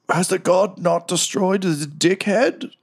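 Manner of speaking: very high, slow ancient creature voice; unearthly voice. Acted. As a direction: stretch it out like something long dead waking.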